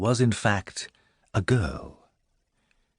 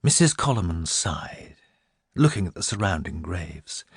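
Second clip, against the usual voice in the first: ordinary delivery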